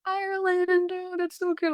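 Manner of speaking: high, breathy-free gush of amazement; American students gushing over Ireland, high and amazed. Retold or imitated. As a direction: imitate the starstruck Americans, high and soft. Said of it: "they're like".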